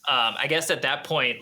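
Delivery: normal talking voice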